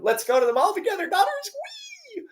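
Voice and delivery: high, fast, gushing teen-girl mock voice; mocking giddy mom. Mother mocking herself as teen for daughters, high giddy squeal. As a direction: squeal it like a silly mom pretending to be a teenager.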